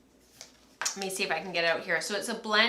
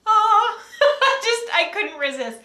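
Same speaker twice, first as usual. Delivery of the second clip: higher, louder laughing delight; giddy laughing; 13 semitones higher, 9 dB louder than usual. Real, not acted. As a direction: giggle over a treat you couldn't resist. Gushing over new spindle.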